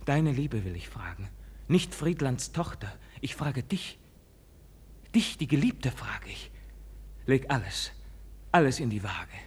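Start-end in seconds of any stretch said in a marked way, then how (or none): 0.1-7.9 hushed plea. Acted. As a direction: lay your heart open in near whisper. Intimate plea to beloved.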